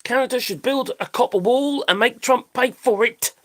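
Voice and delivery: ordinary talk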